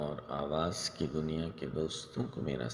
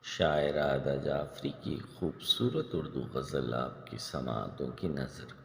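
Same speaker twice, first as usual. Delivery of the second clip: ordinary talk, nothing marked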